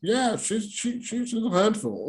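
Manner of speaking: high-pitched, stammering impression; imitating the husband. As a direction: imitate a flustered man in a high voice. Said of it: "He start talking. He's like".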